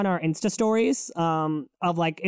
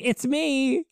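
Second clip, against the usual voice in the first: deity's voice; 6 semitones higher than usual. Retold or imitated. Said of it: "she came as one of her forms and was like".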